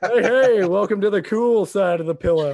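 smooth, loud suave charmer voice; Billy Dee Williams impression. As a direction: slick ladies' man greeting. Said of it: "that was spot on".

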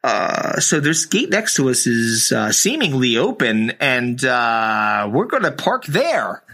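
mock captain on the intercom, unhurried; a hint of an airline captain's PA voice; pilot announcement. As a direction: do the bored pilot again, slow at the end. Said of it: "they go like this".

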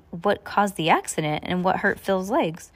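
plain reading voice

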